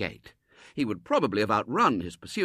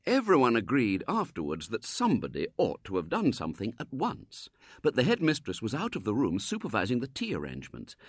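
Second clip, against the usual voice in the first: ordinary delivery, nothing marked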